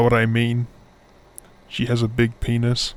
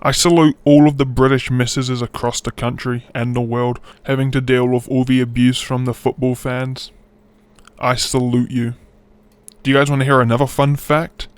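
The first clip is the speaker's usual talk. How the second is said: ordinary talk, nothing marked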